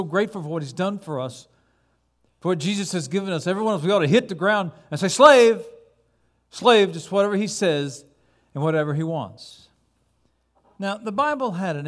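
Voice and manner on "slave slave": slave shouted loud and high; fervent cry. Acted. Preacher showing the church what to cry out, loud. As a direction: cry it out in surrender. Congregation urged to say it.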